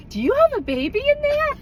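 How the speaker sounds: put-on high, childlike curious voice; a little boy's wondering question; kid's question. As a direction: ask like a small nosy kid. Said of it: "and he goes".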